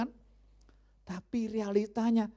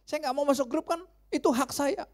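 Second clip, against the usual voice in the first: stubborn neighbour; 9 semitones higher than usual. Retold or imitated. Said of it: "kalau dia bilang sama anak saya".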